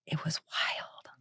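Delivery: whispered, quick and secretive; hushed whisper. Real, not acted. Telling Logan about the psychic video, whisper. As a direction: lean in and whisper it.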